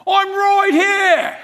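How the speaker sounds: put-on loud, high, drawn-out English accent; mock English accent call from afar; English accent shout. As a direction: holler it in a broad English accent. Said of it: "he was from England".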